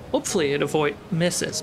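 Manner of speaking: normal talking voice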